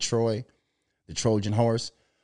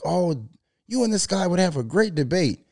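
higher, lightly put-on friendly voice; mimicking a friend; 6 semitones higher than usual. Mister Neil suggesting a debate, lightly voiced and higher. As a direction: chirp it like an eager matchmaker.

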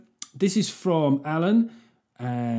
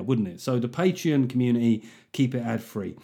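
ordinary talk

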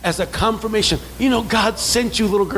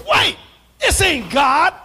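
sudden realization; 8 semitones higher, 3 dB louder, slower than usual. Retold or imitated. Realizing the caller is deceiving.

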